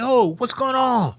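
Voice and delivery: panicked shout in a dark cell; startled yell. Retold or imitated. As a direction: call out in alarm. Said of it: "I screamed".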